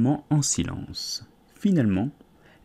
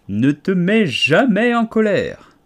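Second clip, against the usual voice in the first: higher, louder, slow pronouncement; declaring loudly; 7 semitones higher, 8 dB louder, slower than usual. A narrator's reading. Caterpillar grandly declares its advice to Alice. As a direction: lay it down like a grand rule. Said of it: "déclara la chenille".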